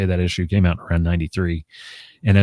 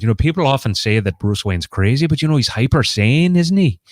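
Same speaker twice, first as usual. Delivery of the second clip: put-on lilting, higher-pitched Scottish accent; 5 semitones higher, 3 dB louder than usual. Quoting a comics writer from an interview, Scottish accent. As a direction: put on a Scottish accent, rising high towards the end. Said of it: "in that Scottish accent".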